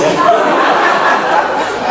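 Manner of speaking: high, loud burst of laughter. Real, not acted. Preacher laughing at his son's quip, loud.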